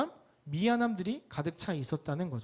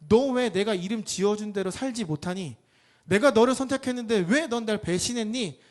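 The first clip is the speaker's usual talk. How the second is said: louder, raised accusing questions; acted reproach; 4 semitones higher, 7 dB louder than usual. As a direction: confront a friend who betrayed you. Imagined reproach of Peter. (배신을 따져 묻는 꾸짖음)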